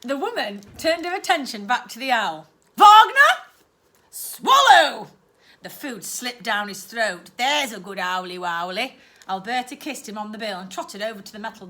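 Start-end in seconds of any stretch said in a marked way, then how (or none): 2.8-5.1 much higher and louder; aunt commanding her owl; sharp command. Acted. 7.4-9.0 aunt praising her owl; doting praise. Acted.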